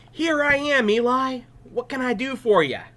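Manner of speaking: put-on high, loud, eager young voice; a keen boy reporting for duty; boy answering the priest brightly. Eager boy runs to Eli.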